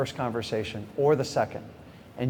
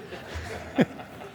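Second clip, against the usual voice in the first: soft breathy laugh; 9 dB quieter than usual. Real, not acted. Laughing at own story.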